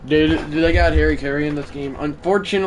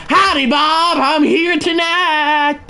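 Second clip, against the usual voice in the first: put-on voice; 7 dB louder, slower than usual. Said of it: "That's the Dorito says".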